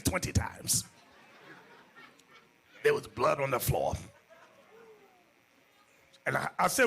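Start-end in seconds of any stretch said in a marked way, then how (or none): 0.0-0.8 unvoiced whisper; whispered admission. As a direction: breathe out the answer. Sister admitting quietly.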